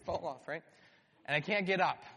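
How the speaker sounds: ordinary talk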